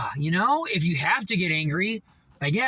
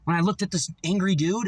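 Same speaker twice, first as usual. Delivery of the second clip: ordinary talk, nothing marked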